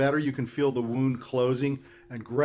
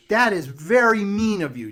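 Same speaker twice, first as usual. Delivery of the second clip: scolding witch voice; 6 semitones higher, 6 dB louder than usual. Acted.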